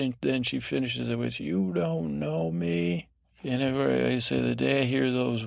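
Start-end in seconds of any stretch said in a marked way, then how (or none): 1.4-3.1 high, drawn-out mock teen protest; teenage outburst. Teenage girl shutting her guardian down, mock-dramatic protest. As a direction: stretch it out like a misunderstood teenager. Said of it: "talk about teenage moment".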